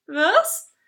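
plain reading voice

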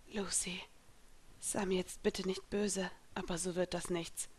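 soft, breathy whisper; whispered warning just before the big heave. Acted. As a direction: whisper it close and apologetic. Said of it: "flüsterte sie".

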